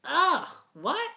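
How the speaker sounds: very high, squeaky little engine; Thomas. Acted. Engine horrified by his new voice, in a high Thomas voice. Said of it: "out of Thomas' voice though".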